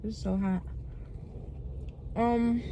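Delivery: talking through a mouthful of food; mouth full. Real, not acted. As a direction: speak round a bite of food. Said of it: "I'm talking with my mouth full".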